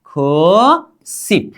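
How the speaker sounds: खो सिप stretched syllable by syllable; very slow demo. Shown as a demonstration. Teacher modelling ninety for the class, very slowly. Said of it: "अब इसका उच्चारण क्या करेंगे?".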